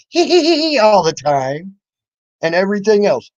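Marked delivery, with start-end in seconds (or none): 0.1-0.8 much higher mocking hee-hee giggle. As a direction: giggle like a silly girl. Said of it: "That's why you go".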